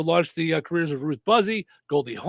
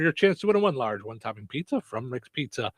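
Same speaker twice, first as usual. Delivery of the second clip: radio contest promo; 2 semitones higher, 8 dB louder than usual. Acted. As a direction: kick off the contest with big energy. Host launches the pizza quiz.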